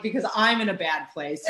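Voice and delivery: ordinary talk, nothing marked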